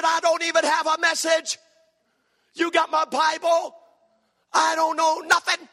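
high-pitched, loud comic panic; hammed-up frantic objection; panicked protest. Acting out his panicked protest.

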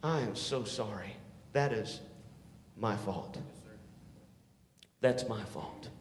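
soft, apologetic murmur; quiet sorry. Retold or imitated. Youth worker owning the blame, said quietly. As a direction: quietly own the fault. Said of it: "He's gonna sit back and go".

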